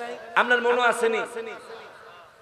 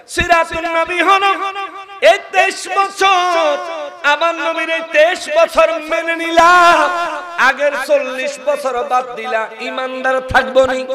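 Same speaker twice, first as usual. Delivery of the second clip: full-force preaching; 10 semitones higher, 10 dB louder than usual. Real, not acted. Preacher challenges the crowd on the Prophet's life, full-force preaching. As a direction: ring it out to the whole gathering, stretching the endings.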